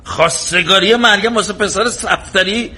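high-pitched indignant outburst. Acted. Father hears Safdari's son wants his daughter, outraged shouting. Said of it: "صدا تو بیار پایین".

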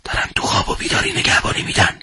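hushed whisper near the enemy; whispering under cover. A narrator's reading. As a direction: breathe the words so the patrol can't hear. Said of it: "محمد آهسته گفت".

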